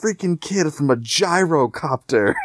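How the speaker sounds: high, exasperated villain voice; a high-pitched, huffy tyrant complaining over the radio; Pagan impression. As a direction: play the annoyed villain on the radio. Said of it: "you hear Pagan on your radio, and he's like".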